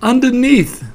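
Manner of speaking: high-pitched squeal from beneath; underneath voice. A narrator's reading. Piglet answering from under Pooh, high squashed voice. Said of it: "in an underneath sort of way".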